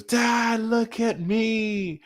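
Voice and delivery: high, drawn-out mock cry; imitated plea to Dad. Son calling on his father to look, high drawn-out plea. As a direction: stretch it out in a high pitch, calling for attention.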